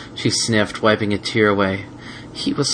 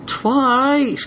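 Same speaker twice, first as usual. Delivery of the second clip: complaining. Acted. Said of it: "complained Spike".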